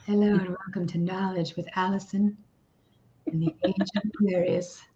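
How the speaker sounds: a light, soothing hypnotist voice. Pretend. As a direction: lull them like a stage hypnotist. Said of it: "Use your hypnotist voice".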